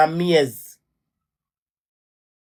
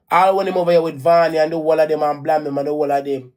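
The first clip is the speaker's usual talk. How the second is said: ordinary talk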